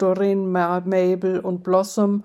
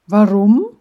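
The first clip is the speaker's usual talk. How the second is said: loud, startled question; surprised; 12 dB louder than usual. Acted. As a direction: blurt it out in shock. Friend reacts to odd news.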